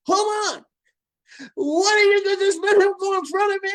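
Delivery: hammed-up outraged woman voice; mock indignant lady. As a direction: squeal like an offended customer. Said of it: "She was like".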